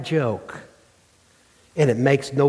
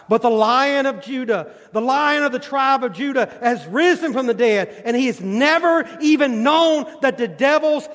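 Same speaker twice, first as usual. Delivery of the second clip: full-force preaching; 7 semitones higher than usual. Acted. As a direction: proclaim victory, rising phrase by phrase.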